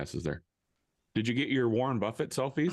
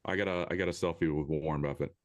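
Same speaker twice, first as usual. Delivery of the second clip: mock humble brag; 7 semitones lower than usual. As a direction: parrot the brag back, deadpan and low. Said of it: "the Nebraska humble brag".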